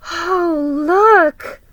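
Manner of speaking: put-on girl crying out in delight; excited cry. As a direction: gasp with joy at the gift. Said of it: "cried Molly".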